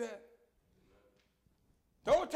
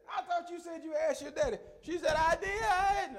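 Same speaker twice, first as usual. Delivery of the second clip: lightly voiced mother, higher; mama's voice; 11 semitones higher, 4 dB louder than usual. Son retelling his mother defending the girl, lightly higher. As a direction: play mama confused.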